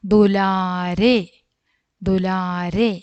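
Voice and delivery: stretched out syllable by syllable; very slow model word. Shown as a demonstration. Teacher modelling the word very slowly. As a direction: draw the word out for the pupils. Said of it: "teacher models the word slowly".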